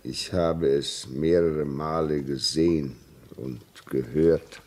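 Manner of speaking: slow, low, halting admission; grave confession. Acted. Signalman admitting he has seen the ghost, slow and grave. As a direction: admit it slowly and heavily, with pauses.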